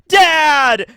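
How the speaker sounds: loud, high cry of "Dad!"; joyful shout. Pretend. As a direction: call out to a long-lost father at full voice. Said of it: "being like".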